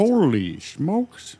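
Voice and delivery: high, slow, drawn-out astonishment; mock amazement. Co-host reacting to five hundred deaths, drawn-out mock amazement. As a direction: stretch both words in comic shock. Exaggerated reaction to deadly town.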